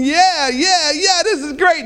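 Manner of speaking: high-pitched fan celebration; excited cheering. Retold or imitated. Dad reliving a touchdown on the table, excited cheering. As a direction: re-enact a jubilant sports fan's cheer.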